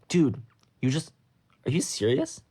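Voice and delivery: indignant calling out. A narrator's reading. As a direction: call after him in disbelief. Kenny calls after Sok in disbelief.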